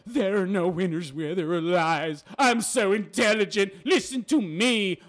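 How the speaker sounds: slow, affected self-important voice; mock-profound woman. Mocking a wife who thinks she's profound, pompous. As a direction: put on a pompous voice, stretching the words. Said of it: "she thinks she's profound and intelligent".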